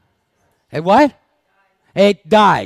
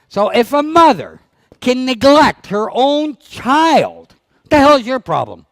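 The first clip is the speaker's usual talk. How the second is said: scolding rant; 7 semitones higher, 5 dB louder than usual. Real, not acted. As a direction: berate the audience like a fed-up trainer. Trainer scolding dog owners.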